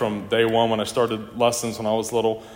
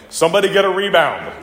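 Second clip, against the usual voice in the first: louder and higher, a half-laughing mock yell; retold sideline yell; 6 semitones higher, 5 dB louder than usual. Dad at a game yelling to players, mock yell. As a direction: call for the rebound like a coach from the bleachers. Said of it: "I can still hear your dad yelling".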